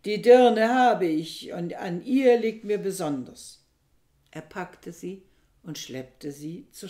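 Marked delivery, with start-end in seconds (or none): 0.0-3.6 triumphant shout. A narrator's reading. Aristippos holding his wife in his grip, loud triumphant shout. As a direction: gloat loudly over the caught woman. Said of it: "rief Aristippos".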